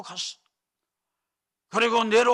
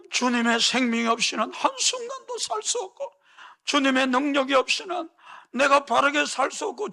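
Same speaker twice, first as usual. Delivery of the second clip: higher, pleading voice with a mock sob in it; tearful prayer; 7 semitones higher than usual. Peter praying in tears every morning, acted higher plea. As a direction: plead like a broken man praying at dawn. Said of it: "눈물로 베드로가 아침마다 기도했다는 거예요".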